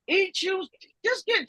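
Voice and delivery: normal talking voice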